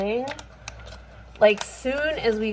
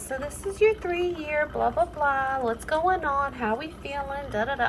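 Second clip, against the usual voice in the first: high, softer sing-through of routine questions; higher-pitched mock doctor patter; imitated doctor; 5 semitones higher, 4 dB quieter than usual. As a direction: mimic a doctor rattling off pleasantries. Voicing the doctor's chirpy questions.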